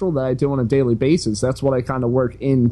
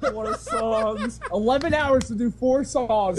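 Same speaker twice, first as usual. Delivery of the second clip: laughing; 7 semitones higher than usual. Real, not acted. Teasing bandmate about studio time.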